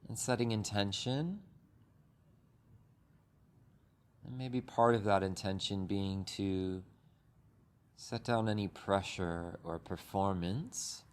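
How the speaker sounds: unhurried meditation guide with long pauses; calm, even voice, leaving space between phrases; guided meditation. Acted. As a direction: ease listeners gently through breath and body.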